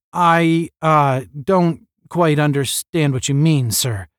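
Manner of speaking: hesitant, halting start; stumbling. A narrator's reading. Cadet dodges the captain's question, stumbling. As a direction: stumble into it with a stalling pause. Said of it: "stumbled Tom".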